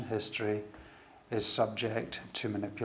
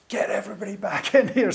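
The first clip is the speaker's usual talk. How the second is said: higher, pressing whisper; urgent whisper; 8 semitones higher, 5 dB louder, faster than usual. Retold or imitated. Producer ordering staff to recall the orchestra, urgent whisper. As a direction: hiss the order in a panic.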